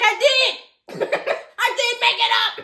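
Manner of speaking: high, loud, laughing I did; gleeful admission. Retold or imitated. Sheriff admitting to Tommy he made it up, gleeful. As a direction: crow it out, half laughing.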